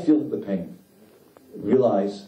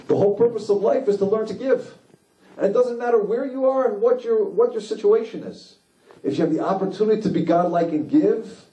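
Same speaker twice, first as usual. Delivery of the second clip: high, ringing sermon voice; impassioned preaching; 12 semitones higher than usual. Acted. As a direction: preach it out with rising fervour. Preacher rises to full force.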